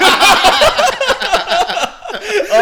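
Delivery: high, loud burst of laughter; big laugh. Real, not acted. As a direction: let a real loud laugh explode. Bursting out laughing mid story.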